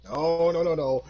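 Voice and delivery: repeated protesting no's; mock horror. Mock horror at weeb talk.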